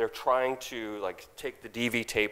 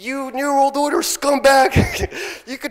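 retold scream; 14 semitones higher, 9 dB louder than usual. Being dragged out, screaming defiance at Brzezinski. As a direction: scream it as they drag you out. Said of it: "screaming at the entire place on the top of my lungs".

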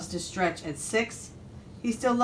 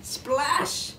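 high, drawn-out storybook splash; high sound-effect word; 7 semitones higher than usual. A narrator's reading. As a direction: let the word burst upward like spray. Sound word as pig lands.

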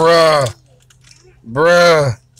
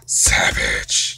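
whisper; 5 dB quieter than usual. Real, not acted. Whispered game remark.

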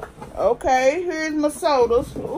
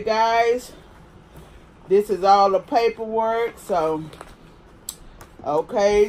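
ordinary talk, nothing marked

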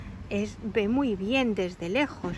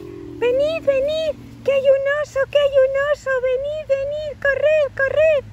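very high, urgent, loud squeal; little squirrel Ethan calling; 6 dB louder than usual. Acted. Little squirrel calling all the children to the bear, high urgent shouting. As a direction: summon everyone in a tiny excited voice. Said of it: "Y llama a todos los niños".

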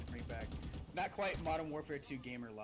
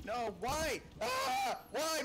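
gamer rage; 6 semitones higher, much slower than usual. Real, not acted. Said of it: "My gamer rage is raging now".

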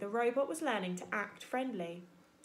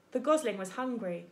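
ordinary delivery, nothing marked

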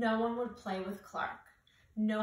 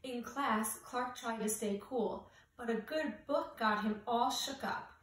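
ordinary delivery, nothing marked

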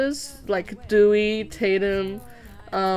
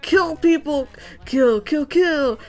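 mock hallucination yell; 6 semitones higher, 4 dB louder, slower than usual. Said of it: "He's like".

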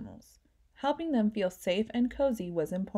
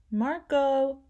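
calling out; 5 semitones higher, 4 dB louder than usual. A narrator's reading. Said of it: "his mom called".

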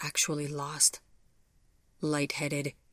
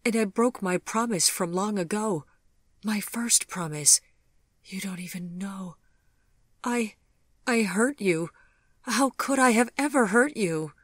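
higher and louder, near tears; remorseful lover confessing, voice climbing in anguish; 6 semitones higher, 5 dB louder than usual. A narrator's reading. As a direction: pour out the guilt as it breaks. Gregory's tearful remorse.

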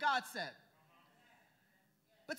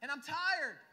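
cried out; 5 dB louder, slower than usual. Retold or imitated. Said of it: "had to say".

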